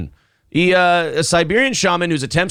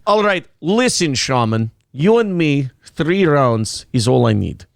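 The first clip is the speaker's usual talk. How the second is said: mock Russian challenge; Russian accent. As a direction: square up in a comic Russian accent. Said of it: "you can do a Russian accent".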